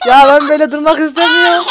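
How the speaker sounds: half-laughing complaint; laughing whine. Real, not acted. Gamer to viewers about being a chicken, laughing whine.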